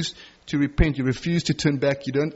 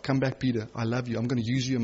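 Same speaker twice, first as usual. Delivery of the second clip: tender Jesus; 4 semitones lower, 7 dB quieter, faster than usual. Retold or imitated. Jesus voiced forgiving Peter.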